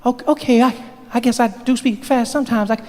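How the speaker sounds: put-on high, halting young voice; still too fast. Barack admits he speaks fast, high and halting. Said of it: "Slower than that".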